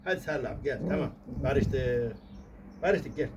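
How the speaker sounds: quiet, gentle coaxing; tender, quiet peace-making with the pet; soft making-up. Pretend. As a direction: softly coax the dog into a hug. Making up with the dog.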